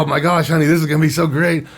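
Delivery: high, loud, quick retold excitement; excited announcement. Husband expecting a raise tells his wife, acted excitement. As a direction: gush with hammed-up delight.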